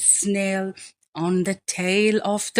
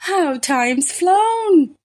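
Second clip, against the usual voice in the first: higher, louder, slow and fond; flock greeting; 9 semitones higher, 5 dB louder, slower than usual. A narrator's reading. The flock greets the returning whale, higher and slow. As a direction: marvel at it warmly. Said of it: "who said".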